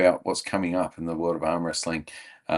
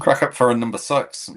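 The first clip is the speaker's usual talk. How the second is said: louder imitation New Zealand accent; Kiwi accent; 3 semitones higher, 5 dB louder than usual. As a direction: echo it in mock Kiwi. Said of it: "is the New Zealand accent there".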